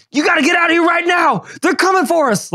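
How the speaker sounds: high, shouted panicked warning. Retold or imitated. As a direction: barge in yelling about danger. Imagined [name] banging at night.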